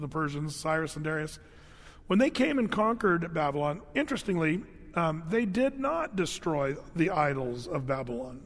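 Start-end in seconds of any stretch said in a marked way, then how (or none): none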